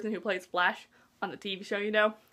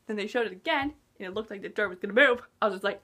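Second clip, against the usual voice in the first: ordinary talk, nothing marked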